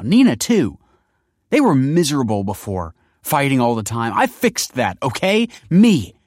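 loud, high, tearful outburst; welling up. A narrator's reading. Kelsey defends what she did for his family, welling up. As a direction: defend yourself hotly, close to tears. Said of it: "welling up".

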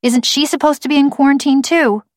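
put-on high child's voice, mildly indignant; challenging girl. A narrator's reading. Girl challenges quarantine rules.

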